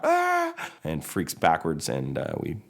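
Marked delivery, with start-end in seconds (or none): 0.0-0.5 high sharp shriek; panicked scream. Acted. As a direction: yelp as the clock ticks. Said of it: "Mater freaks out".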